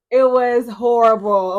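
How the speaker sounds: an exasperated groan of "it was horrible"; loud, slow emphasis. Real, not acted. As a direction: lean hard on each word of "it was horrible". First take, stressed emphatically.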